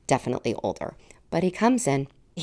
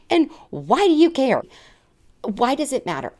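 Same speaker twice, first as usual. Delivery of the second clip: indignant rhetorical question; 12 semitones higher, 6 dB louder than usual. Retold or imitated. Indignant rant at doctor.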